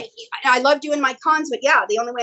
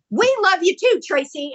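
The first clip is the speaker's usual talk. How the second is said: put-on Marina's accent; 7 semitones higher than usual. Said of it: "you do her accent really well too".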